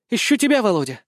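high-pitched, demure reply; modest. A narrator's reading. Girl tells the boy she was looking for him, modestly. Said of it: "скромно ответила Игнатьева".

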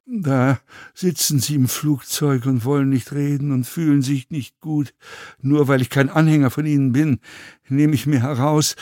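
lightly voiced contrite passenger, measured and even; contrite fellow passenger. A narrator's reading. Passenger reproaches himself to the actor, mildly contrite.

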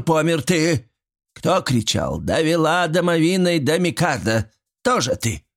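high, indignant village woman's tirade; scolding. Acted. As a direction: fling each accusation back at him. Old woman scolding Mitrich.

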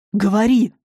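loud barked command; harsh demand. A narrator's reading. Dema pressing the captive girl, harsh command. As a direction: force the answer out of her.

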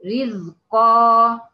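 loud slow model of رزقا; tajweed demo word. Shown as a demonstration. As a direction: model رزقا slowly, the ra held. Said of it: "زي كلمة".

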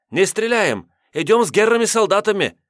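high, loud fake surrender; fake panic. A narrator's reading. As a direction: play the terrified captive.

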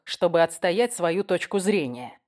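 ordinary delivery

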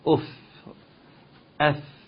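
اف with the fa said without lip rounding; isolated fa. Shown as a demonstration. Teacher isolating the fa sound. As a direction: say اف with no rounding of the lips. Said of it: "بتنطق كده".

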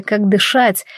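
ordinary delivery

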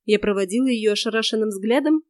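ordinary delivery

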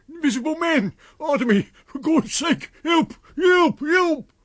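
very high, loud cries for help; terrified screaming. A narrator's reading. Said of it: "his voice shrieking with terror".

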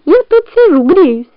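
very high little squeak; tiny high-pitched imitation fox voice; squeaky fake fox. A narrator's reading. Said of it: "голосом лисы".

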